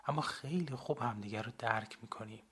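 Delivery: ordinary delivery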